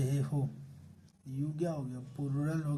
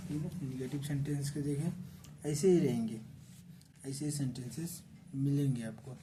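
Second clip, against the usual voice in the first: ordinary talk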